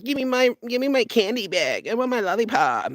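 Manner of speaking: hammed-up spoiled child begging for candy; whiny child. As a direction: whine for the candy like a spoiled kid. Mocking complainers as whiny kids.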